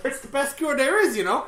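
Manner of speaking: high, loud mock Wisconsin; Wisconsin accent. Hospital local recommending brats, mock Wisconsin accent. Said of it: "they're like".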